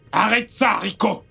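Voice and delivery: very high, loud angry yell; scolding shout. Acted. Father to Rico in a fight, scolding shout. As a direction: yell at him to stop right now. Father breaks up siblings' quarrel.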